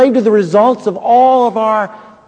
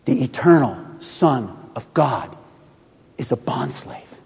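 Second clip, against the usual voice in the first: hushed whisper; 7 semitones lower, 8 dB quieter than usual. Real, not acted. Climax whispered.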